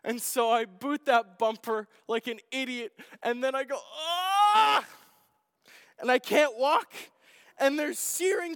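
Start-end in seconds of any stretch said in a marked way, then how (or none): 3.9-4.8 sudden loud cry of pain, pitched high; pained yell. Retold or imitated. Pastor reliving kicking the car, loud pained shout. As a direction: yelp as the toe hits the bumper.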